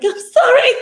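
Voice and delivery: high, loud incredulous I'm sorry; retold shocked squeak, laughing; mock shocked squeak. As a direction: squeak it out in disbelief. Shocked reply to sing request.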